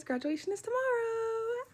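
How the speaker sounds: giddy, high-pitched announcement; excited squeal. Real, not acted. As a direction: squeal the news, stretching the last word. Excitedly announces own graduation.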